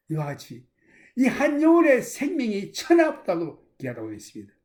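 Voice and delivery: ordinary talk, nothing marked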